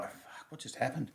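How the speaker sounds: put-on a boy's whispered shock after dad hits the principal; stunned whisper. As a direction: breathe it out in shocked disbelief. Stunned thought after dad's punch.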